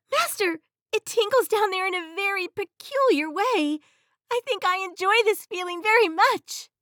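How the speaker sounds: high, loud astonished genie; excited genie. Acted. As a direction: exclaim in wonder, high up. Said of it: "suddenly exclaim".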